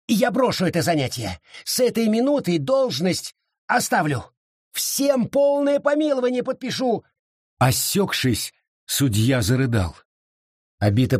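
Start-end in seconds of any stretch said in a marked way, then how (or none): 0.1-7.0 rising desperate promises; desperate pleading. Acted. Judge swears to Abita he will quit, pleading more desperately. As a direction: plead faster and higher each line.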